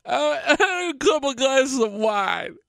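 high teary mock voice breaking into a laugh; imitating tipsy wife, laughing. Husband mimicking wife repeating her confession with a laugh.

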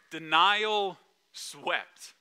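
Denial swept drawn out very slowly, each word lingered on; slow rhyme. Shown as a demonstration. Preacher teasing the congregation, savouring Denial swept slowly. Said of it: "No more rhymes".

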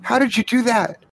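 put-on very high, insistent little kid; eager child voice. Child runs over to Reggie demanding answers, high eager child voice. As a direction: pepper them with questions in a high child's voice.

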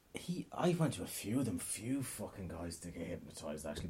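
hushed aside; whisper. Real, not acted. Admitting to the audience, whispered aside. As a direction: drop to a confiding whisper.

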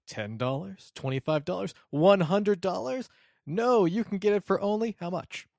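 booming pitchman selling hard; infomercial. Acted. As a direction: sell it like a late-night TV ad. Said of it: "infomercial voice here".

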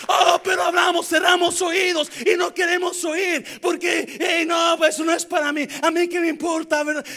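mocking dismissive churchgoer. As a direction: brush off the Word like it doesn't matter. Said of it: "Eso es mentira".

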